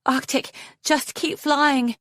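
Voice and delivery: high, slow urgent call; shouted urging. A narrator's reading. Foeslayer urging Arctic onward, shouting. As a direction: urge him on, drawn out and high. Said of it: "she shouted".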